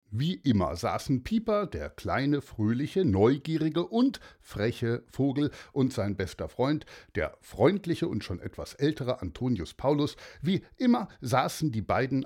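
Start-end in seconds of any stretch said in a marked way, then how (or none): none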